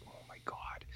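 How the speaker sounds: whispered mock disbelief; whispered exasperation. Viewer reacting to Sweetie's demands, whispered exasperation. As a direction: whisper it as an appalled aside.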